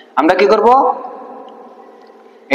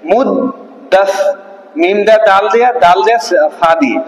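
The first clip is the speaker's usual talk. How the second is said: মুদ্দাসিরু stretched out very slowly; very slow spelling; slower than usual. Shown as a demonstration. Teacher spells মুদ্দাসিরু very slowly for pupils. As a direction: drag out মুদ্দাসি and মুদ্দাসিরু syllable by syllable. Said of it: "আগে দেখেন".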